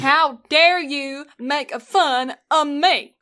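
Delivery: mimicked Southern outrage, loud and slow; mock-offended Southern twang; Southern accent. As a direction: echo it in a high, exaggerated Southern drawl. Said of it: "I like how the accent".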